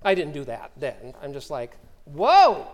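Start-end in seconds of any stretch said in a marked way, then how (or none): 2.0-2.6 loud, amazed whoa; surprised whoa. Real, not acted. Pastor stunned by the lady's promise of blessing, a loud surprised whoa. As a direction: let it out, taken aback.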